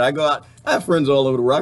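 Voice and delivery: normal talking voice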